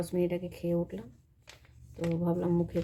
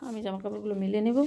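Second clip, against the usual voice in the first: normal talking voice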